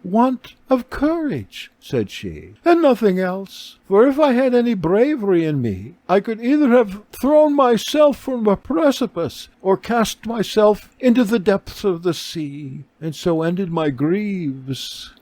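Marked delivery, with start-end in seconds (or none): 0.0-1.7 much higher, slow and weary; a broken woman admitting her weakness; despairing beggar woman. A narrator's reading. Beggar woman tells Kepha her failing, high and slow. 2.6-15.1 a broken woman admitting her weakness; despairing beggar woman. A narrator's reading.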